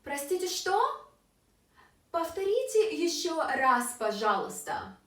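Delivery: high "что", then falling to "пожалуйста"; question then request. Shown as a demonstration. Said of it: "So it can sound like this".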